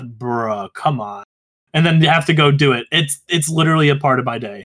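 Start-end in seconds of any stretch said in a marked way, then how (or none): 0.0-1.2 softer, lower "Bruh, come on" grumbled to himself; muttered under breath. Retold or imitated. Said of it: "I literally just mutter under my breath".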